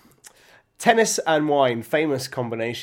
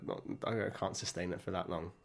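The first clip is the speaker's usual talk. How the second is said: ordinary talk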